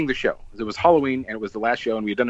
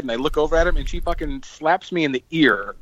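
usual speaking voice

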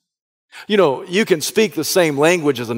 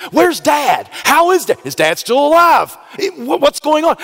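high, loud, urgent questions; anxious shouts; 11 semitones higher, 4 dB louder than usual. Acted. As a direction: cry out for news of his father. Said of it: "he thinks to himself".

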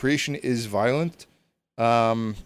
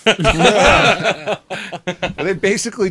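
laughing; 8 semitones higher, 6 dB louder, slower than usual. Real, not acted. Laughing at own story.

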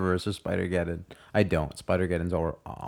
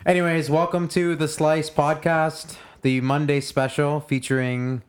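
podcast host intro; 5 semitones higher, slower than usual. Acted. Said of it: "podcast intro".

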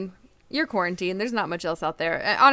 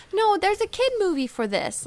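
put-on high, childlike daughter's voice; little daughter voice; 13 semitones higher than usual. A mother imitating her daughter asking for the potty in a high childlike voice. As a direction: pipe up like a little girl.

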